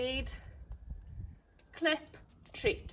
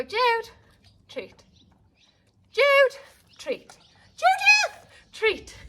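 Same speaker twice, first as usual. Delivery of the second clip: name called up high and loud, louder each time; rising call voices; 11 semitones higher, 9 dB louder, much slower than usual. Shown as a demonstration. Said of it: "So you do different voices".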